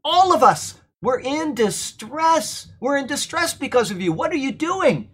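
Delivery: distressed, high-pitched rebuke; Paul. Acted. Apostle to the straying churches on behalf of all, distressed rebuke. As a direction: plead in dismay, pitch well up, leaning on key words. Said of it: "That's the emphasis that Paul is giving".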